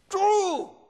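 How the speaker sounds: high, loud accusing scream, acted out; angry yell. Woman spotting the visiting pastor, acted angry scream. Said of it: "Y comienza a gritarme".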